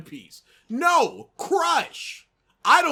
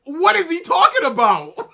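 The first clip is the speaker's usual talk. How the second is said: much louder and higher, incredulous; exasperated outburst; 6 semitones higher, 9 dB louder than usual. Real, not acted. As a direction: throw up your hands in disbelief.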